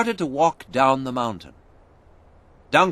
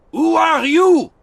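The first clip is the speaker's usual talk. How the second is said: much higher, loud shout; 9 dB louder than usual. A narrator's reading. The Frenchman shouting at strangers in the fog. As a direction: demand it at full voice. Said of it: "shouted the Frenchman".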